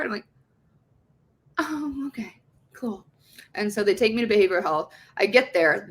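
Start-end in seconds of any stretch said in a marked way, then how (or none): 1.6-3.1 small, soft, reluctant reply; deflated acceptance. Retold or imitated. Patient learning where she's headed, small deflated voice. Retold reaction to unwelcome news.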